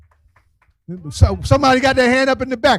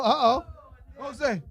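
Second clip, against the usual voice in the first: usual speaking voice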